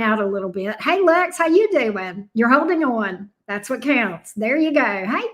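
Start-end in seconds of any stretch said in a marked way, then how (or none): none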